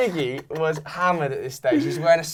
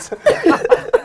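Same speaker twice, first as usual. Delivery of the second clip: high laugh; 5 dB louder than usual. Real, not acted. Laugh after story.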